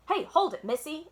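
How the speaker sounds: mildly voiced gruff man calling her; grouchy yell. A narrator's reading. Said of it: "it yelled".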